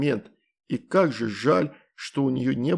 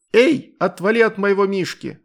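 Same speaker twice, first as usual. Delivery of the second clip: loud, high shout from above; yell; 8 semitones higher, 7 dB louder than usual. A narrator's reading. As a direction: yell it out, furious. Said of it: "раздался вопль с небес".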